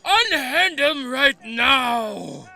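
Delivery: loud, slow, high heroic command; commanding demand. Acted. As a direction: order them to let go, loud and slow. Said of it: "the other person goes".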